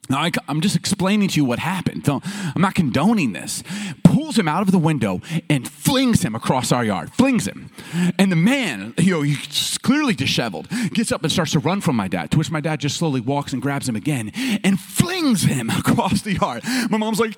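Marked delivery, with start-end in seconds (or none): none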